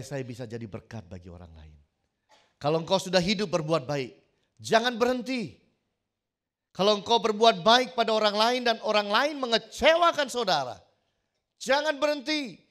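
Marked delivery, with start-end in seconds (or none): 2.6-10.8 loud, high, fervent exhortation; rising shouted sermon appeal; preaching at full force. Acted. 11.6-12.6 high, loud "jangan berhenti" with a falling close; full-force sermon command; preached shout. Acted.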